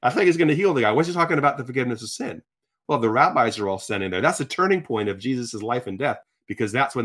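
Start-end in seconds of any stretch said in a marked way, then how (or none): 0.0-2.4 higher, incredulous questioning; baffled onlooker. Acted. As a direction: react like a puzzled bystander. Acting out puzzled onlookers to Jesus.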